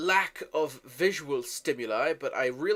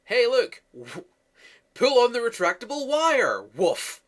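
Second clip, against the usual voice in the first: high, loud sarcastic mock of the movie; 7 semitones higher, 6 dB louder than usual. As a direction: sneer at the lame special effect, pitched up. Mocking the movie's force push.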